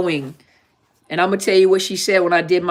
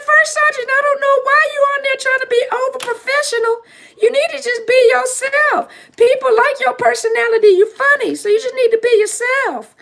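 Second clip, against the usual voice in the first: much higher made-up voice for a scolding friend; made-up Tasha voice. Retold or imitated. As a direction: squeak it way up like a nagging old friend. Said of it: "That's how she sounded".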